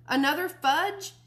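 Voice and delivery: "Another fudge?" ऊँचे सुर और ज़ोर से, अंत में उठता; बेटा माँ-बाप पर ऊँची आवाज़ में चिल्लाता है; shouting. Acted. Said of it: "I kept right on shouting".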